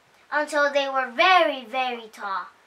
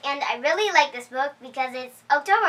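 ordinary delivery, nothing marked

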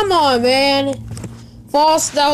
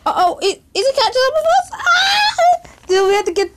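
laughing panic, much higher; being chased; 7 semitones higher than usual. Real, not acted. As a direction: scream and laugh while fleeing.

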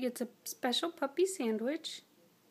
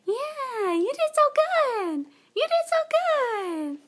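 squeaky praising a dog; 13 semitones higher, 9 dB louder, slower than usual. Real, not acted.

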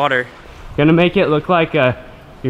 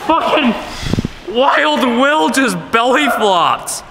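excited; 11 semitones higher than usual. Real, not acted. Friend belly flops into water.

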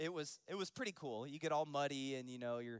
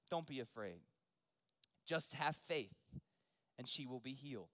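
gentle reassurance. Acted. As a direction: reassure a frightened father, unhurried. Jesus reassures grieving Jairus.

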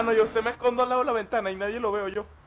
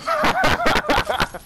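loud high laugh; 6 dB louder than usual. Real, not acted. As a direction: burst of shrill laughter. Friend dies in game.

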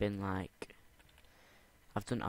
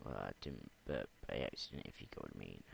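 sly quiet aside; 11 semitones lower, 6 dB quieter, faster than usual. Real, not acted. Sly aside after burping.

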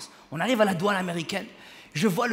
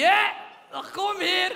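imitated father's English; 9 semitones higher, much slower than usual. Father calling someone back from afar, high accented shout. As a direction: holler it like a dad with poor English. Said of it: "Et je lui dis".

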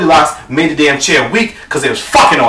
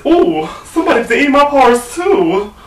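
Mama Bear; 7 semitones higher than usual. Acted. Mother bear finding her porridge eaten, high mock dismay. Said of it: "So then Mama Bear says".

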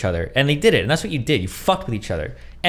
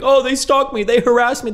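mock victim; 11 semitones higher than usual. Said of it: "he can constantly reframe, like".

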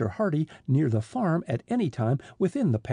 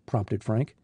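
usual speaking voice

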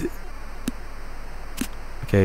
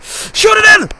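loud, high urgent command; desperate gamer yell; frantic shout; 5 dB louder, slower than usual. Real, not acted. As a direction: scream for the shot. Urging a shot on goal.

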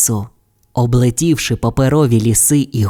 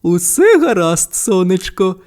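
smiling mother; 6 semitones higher than usual. A narrator's reading. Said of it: "посміхнулася їй матуся".

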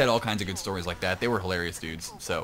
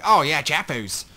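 put-on loud, drawn-out Australian accent, higher-pitched; 5 semitones higher, 7 dB louder, slower than usual. Caster imitating Australians hailing their celebrity, Australian accent. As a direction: exclaim it in a mock Australian accent. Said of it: "don't make me do that accent".

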